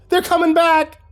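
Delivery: high frantic yell. Acted. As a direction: shout the warning in panic. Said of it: "Brenda yelled".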